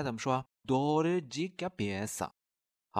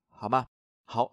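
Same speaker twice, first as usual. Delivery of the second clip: ordinary talk, nothing marked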